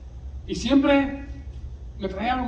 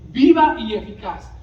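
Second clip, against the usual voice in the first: louder, driving stress on viva; emphatic; 2 semitones higher, 8 dB louder than usual. Acted. Preacher to congregation, loudly proclaiming the phrase. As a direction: proclaim it with force.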